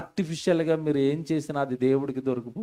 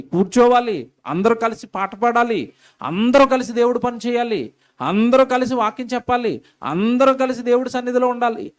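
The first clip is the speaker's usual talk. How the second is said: higher and louder, pounding repetition; rousing sermon climax; fiery preaching; 5 semitones higher, 5 dB louder than usual. Acted. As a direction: preach it hard to the congregation. Preaching with rising force.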